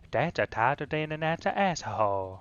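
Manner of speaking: hammed-up Southern saying in a Japanese accent. Shown as a demonstration. Trying the sentence a friend supplied, Southern Japanese accent. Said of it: "A really Southern sentence".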